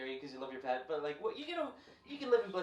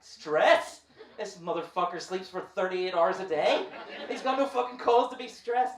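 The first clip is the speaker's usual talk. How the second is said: louder, incredulous rant; indignant outburst; 3 semitones higher, 7 dB louder, slower than usual. Retold or imitated. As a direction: protest in mock outrage. Indignant owner to vet.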